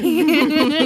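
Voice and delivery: high, loud amused laugh; burst of laughter. Real, not acted. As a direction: laugh out at the memory. Amused at sketchy kid.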